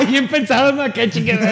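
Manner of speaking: laughing through the words. Real, not acted. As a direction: burst of laughter mid-phrase. (riéndose)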